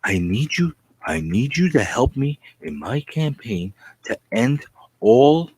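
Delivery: ordinary talk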